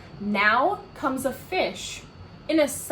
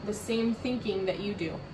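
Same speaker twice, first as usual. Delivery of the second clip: ordinary delivery, nothing marked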